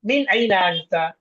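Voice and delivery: slow, deliberate Arabic question; slow model reading. Shown as a demonstration. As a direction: read it slowly so students can copy. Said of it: "তুমি কোত্থেকে?". (ধীরে ধীরে আরবি উচ্চারণ)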